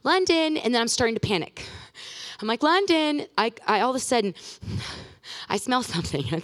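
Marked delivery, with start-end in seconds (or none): 0.0-0.6 put-on raised-pitch call for a child; singled-out name called high, imitated. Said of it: "So I'm calling for her". 2.6-3.3 retold high call for a child; imitated searching call. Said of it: "So I'm calling for her".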